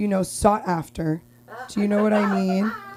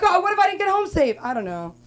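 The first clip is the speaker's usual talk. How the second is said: ordinary talk, nothing marked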